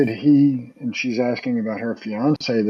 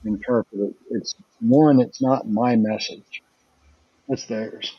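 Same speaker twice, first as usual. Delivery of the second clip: ordinary talk, nothing marked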